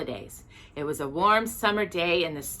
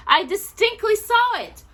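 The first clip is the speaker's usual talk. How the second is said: much higher, louder, slowing insistence; insisting; 13 semitones higher, 6 dB louder, slower than usual. Acted. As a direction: press the point on his wife. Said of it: "he insisted".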